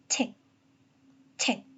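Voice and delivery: "책" said as English "tech", the t bursting out; isolated "책" as "tech" with a puff of air on the t; aspirated T. Shown as a demonstration. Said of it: "뭔가 소리가 터지면서 나오는".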